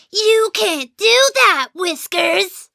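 mock-stern scolding, pitched high; slow, exaggerated mimicry of a mean dad. A narrator's reading. Young mouse mimics dad forbidding stunts, mean mocking voice. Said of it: "in a very mean voice".

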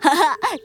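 hearty laugh. Acted. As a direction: belly laugh. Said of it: "捂着肚子哈哈大笑起来".

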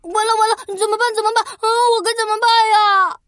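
high, fast frantic wail; panicking. Acted. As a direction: squeal in total panic. Prince panics, monster pounces.

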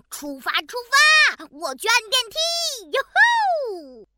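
high, loud little cheer; a small bear shouting with glee; tiny squeaky trying to roar. Acted.

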